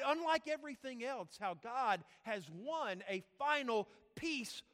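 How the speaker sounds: loud, high, impassioned sermon delivery; forceful preaching. Real, not acted. Preacher telling the congregation of no peace, impassioned. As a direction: drive each phrase out at full force.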